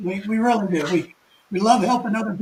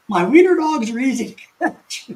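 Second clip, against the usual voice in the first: laughing; 5 semitones higher, 5 dB louder than usual. Real, not acted. Laughing at chat comment.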